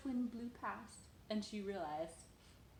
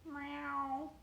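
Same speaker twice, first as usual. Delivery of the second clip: cat meow; 6 semitones higher, 5 dB louder than usual. Pretend. Mother meows with toddler.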